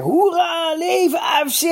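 high, lusty hurrah; shouted cheer. A narrator's reading. Ben ter Heij cheers the club over the chairman, shouting. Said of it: "schreeuwde Ben ter Heij".

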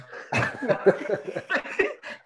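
breathless high-pitched laugh; high wheezing laugh. Real, not acted.

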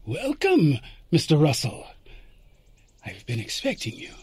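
lightly voiced mysterious old man; calm knowing gentleman. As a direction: greet him as if you knew he'd come. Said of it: "he took the pipe out of his mouth and said".

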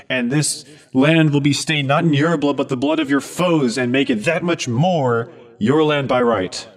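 loud, rallying battle speech; rally the crowd like a paladin; rousing speech. Acted.

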